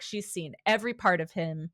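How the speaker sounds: normal talking voice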